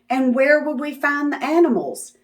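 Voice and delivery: ordinary delivery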